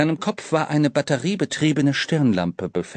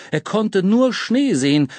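plain reading voice

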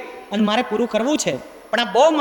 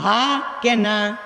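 drawn-out question; much slower than usual. Acted. Asks the parents to answer.

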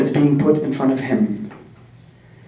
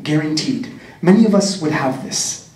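ordinary talk, nothing marked

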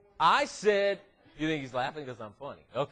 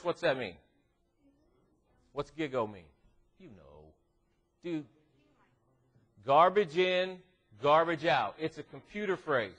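ordinary talk

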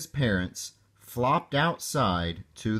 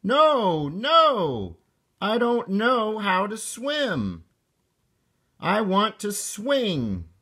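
high, emphatic little-frog refusal; protesting child; 10 semitones higher than usual. A narrator's reading. Froggy refusing his mother's invitation to swim, high protest.

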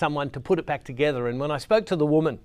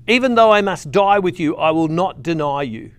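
emphatic vow; 2 semitones higher, 8 dB louder than usual. Acted. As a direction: proclaim it boldly as Peter's promise. Peter's vow, first emphatic take.

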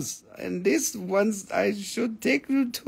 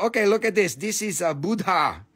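ordinary talk, nothing marked

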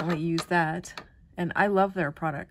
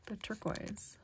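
whisper; 11 dB quieter than usual. Real, not acted. Admiring a butterfly, whispered.

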